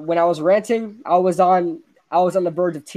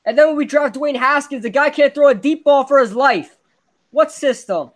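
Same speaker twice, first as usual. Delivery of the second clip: higher, louder fan rant; heated rant; 7 semitones higher, 4 dB louder than usual. Real, not acted. Fan venting to listeners about the quarterback, heated. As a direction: vent at full steam.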